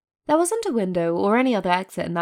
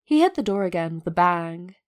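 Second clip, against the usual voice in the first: usual speaking voice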